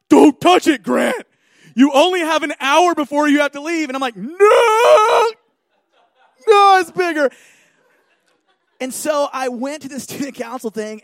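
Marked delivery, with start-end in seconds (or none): 0.1-3.9 loud, high panicked shouting; frantic self-scolding. Retold or imitated. Frantically scolding himself in retelling. 4.1-7.3 high, loud anguished wail; hammed-up cry of despair; mock horror. Retold horror at growing pimple.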